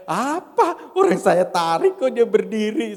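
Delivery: high, loud astonished thought; mock disbelief at himself; inner surprise. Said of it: "dalam hati saya". (heran dalam hati, nada tinggi)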